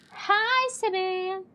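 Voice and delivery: chirpy arrival hello; high cheerful newcomer; bright high greeting. Acted. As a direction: pop in cheerfully. New character greeting.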